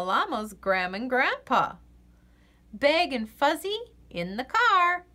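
ordinary delivery, nothing marked

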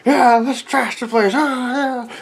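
wild audience rioting, loud and high; rowdy mob. Acted. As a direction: egg the mob on to wreck the place. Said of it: "the audience was going crazy".

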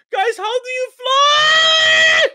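high, loud, drawn-out yell; panicked calling out. Acted. Player floating asks birds for help, panicked calling out. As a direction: stretch the last word into a wail.

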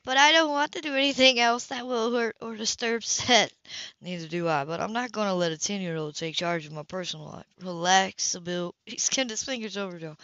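plain reading voice

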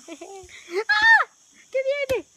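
high laughing shriek at the incoming ball; excited shriek. Real, not acted. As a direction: squeal as it flies at you. Ball coming at her.

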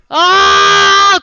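sudden high yelp of fright; real startled cry; startled shout. Real, not acted. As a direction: jump out of your skin and shout. Sudden scare in horror game.

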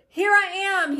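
high, loud, mildly scared reply; startled answer. A narrator's reading. Scared Moses answering the voice, startled. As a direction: answer up high, a touch alarmed.

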